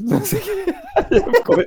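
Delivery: high-pitched, words breaking into laughter; laughing. Real, not acted. Telling friends how dad caught them drunk, laughing. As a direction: crack up while telling the story.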